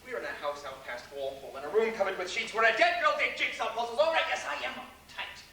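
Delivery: loud, high exasperated rant. Acted. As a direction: vent it all out. Exasperated rant after denial.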